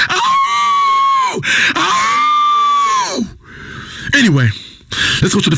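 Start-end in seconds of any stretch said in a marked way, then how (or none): 0.1-3.3 very high, long held howl; piercing sustained "ow-oooh"; animal howl. Acted. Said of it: "howl like an animal".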